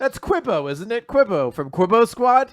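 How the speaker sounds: high, loud, eager explaining; mock insistence. Fan inventing names for Nick, mock-insistent. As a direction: push the fake facts with exaggerated urgency.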